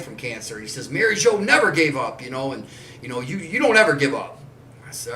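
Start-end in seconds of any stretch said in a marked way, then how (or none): 0.9-4.4 imitating a high, loud angry lecture; a hint of a fierce old man pleading; angry insistence. Old friend invokes his late wife, loud angry insistence. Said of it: "And he got so mad".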